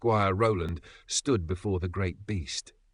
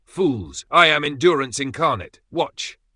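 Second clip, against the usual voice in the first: high, loud, indignant boast; boastful dragon outburst; 7 semitones higher, 7 dB louder than usual. A narrator's reading. Dragon showing off to doubting knight, boastful outburst. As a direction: proclaim it grandly, pitched up and forceful.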